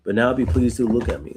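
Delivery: ordinary talk, nothing marked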